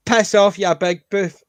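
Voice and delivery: loud mocking shout from the back; retold shout. Barracks heckler shouting at the bully, loud and rude. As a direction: yell it across the barracks. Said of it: "somebody shouted from the back".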